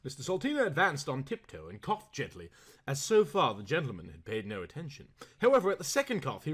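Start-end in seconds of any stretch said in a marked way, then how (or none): none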